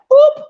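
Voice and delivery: a sharp, high, loud "boop" sound effect; button boop. Retold or imitated. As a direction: mimic a button click with a squeaky pop. Mimics pressing a button.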